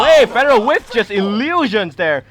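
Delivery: loud, high, excited esports call; game caster at full excitement; live play-by-play. Real, not acted. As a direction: belt out the big play.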